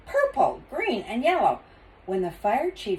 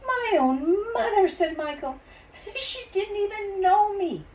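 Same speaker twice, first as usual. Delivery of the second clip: hurt; 14 semitones higher than usual. Acted. Michael hurt, disbelieving.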